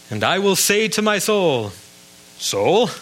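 mock-incredulous, rising on "Soul?"; mocking quote. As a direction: poke fun at the odd phrase "Soul?". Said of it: "who talks like that?".

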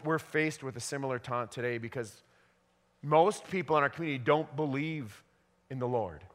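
ordinary delivery

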